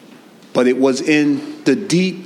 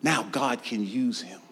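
hushed preaching; 9 dB quieter than usual. Real, not acted. Drop after the climax.

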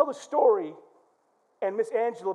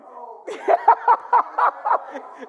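high, rapid cackling laugh; long helpless laugh; big laugh; 14 semitones higher, 6 dB louder than usual. Real, not acted. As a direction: burst out laughing. Laughs at his own story.

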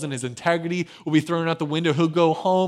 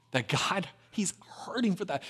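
whispered; 3 semitones higher, 7 dB quieter than usual. Real, not acted. Tender aside on God's hurt.

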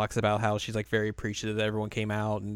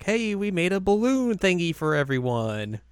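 higher, stretched-out schoolgirl hail; cheery announcement; 7 semitones higher than usual. Retold or imitated. Second years showing off their balloons, voiced high and slow. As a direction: call it out to everyone, imitating.

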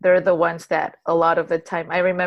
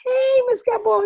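put-on much higher, sing-song kid greeting; kid calling out; 4 dB louder than usual. Students greeting their teacher in Chula Vista, high mock kid call. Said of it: "they're like".

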